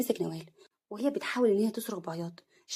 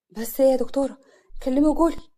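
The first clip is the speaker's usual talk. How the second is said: loud, higher, urgent; anxious demand; 5 semitones higher, 8 dB louder than usual. Acted. Husband pressing doctor for news, anxious and loud.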